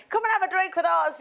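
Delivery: high, eager welcoming invite; friendly invite. Retold or imitated. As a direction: call them over to the pub. Irish inviting the Liverpudlian.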